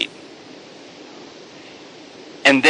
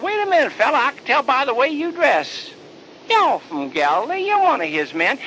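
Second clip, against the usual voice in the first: high accusing man; 12 semitones higher than usual. Acted.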